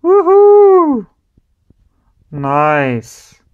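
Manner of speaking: ordinary talk, nothing marked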